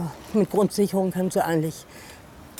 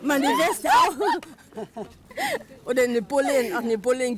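excited laughing ride; 8 semitones higher, slower than usual. Real, not acted. Riding downhill on pushed walker.

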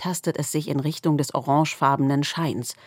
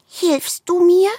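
much higher, slow small plea; pleading squirrel; 7 dB louder, slower than usual. A narrator's reading. Squirrel asks the darkness for help, high and pleading. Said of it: "fragt das Eichhörnchen".

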